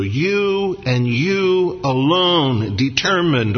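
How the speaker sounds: "You and you alone" stretched out slowly, each YOU stressed; emphatic. Shown as a demonstration. Preacher shows the Hebrew emphasis of the psalm, emphatic "You and you alone". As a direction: lean long and hard on "You" and "alone". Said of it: "it's emphatic in the Hebrew".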